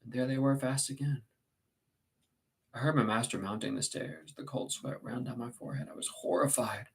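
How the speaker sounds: usual speaking voice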